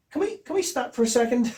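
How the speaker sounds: awed interruption. Retold or imitated. Director halting the audition scene, high excited voice. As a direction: break in excitedly, pitched right up.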